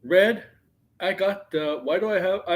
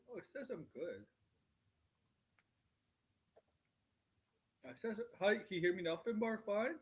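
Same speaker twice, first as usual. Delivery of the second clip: from another room; 3 semitones higher, 14 dB quieter than usual. Real, not acted. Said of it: "it's like you're standing in another room".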